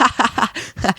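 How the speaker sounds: high mock ha-ha laugh; put-on high laughter; imitated group laughter. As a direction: imitate a crowd cracking up. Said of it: "they all start laughing".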